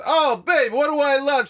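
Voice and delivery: loud, high, excited appeal; Andrew Dice Clay. Retold or imitated. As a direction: imitate a big comic hollering to his girlfriend. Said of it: "He goes".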